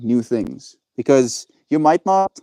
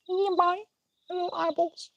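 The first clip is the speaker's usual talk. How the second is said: much higher, softer cutesy voice speaking for the dog; voicing the dog; 10 dB quieter than usual. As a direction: squeak it out as if the dog were saying bye. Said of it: "Say bye to the people, Kobe".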